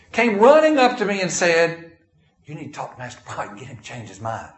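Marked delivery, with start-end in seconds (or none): 2.5-4.5 hushed, rapid, low aside; imitated furtive urging. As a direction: imitate someone urging you on the quiet. Acted urging from church members.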